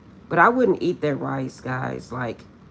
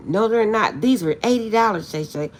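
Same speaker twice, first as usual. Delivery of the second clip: sister protesting; 6 semitones higher, 6 dB louder than usual. Retold or imitated. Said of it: "She's like".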